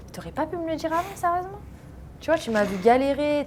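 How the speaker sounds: high, incredulous complaint, mock exasperation; exasperated reproach. [name] teasing Adrienne for hiding she spoke French, exasperated.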